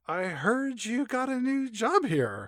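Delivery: high, slow, soft put-on voice; slow high-pitched greeting; [name]. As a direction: stretch it out in a high character voice. [name] greets Carlos at restaurant.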